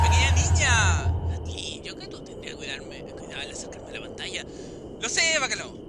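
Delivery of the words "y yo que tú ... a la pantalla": whisper. Acted. Voz interior susurrada.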